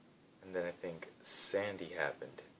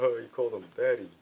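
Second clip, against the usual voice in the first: teasing; 5 semitones higher, 8 dB louder than usual. Retold or imitated. Friends teasing him.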